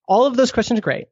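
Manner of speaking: ordinary talk, nothing marked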